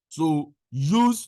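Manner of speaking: ordinary talk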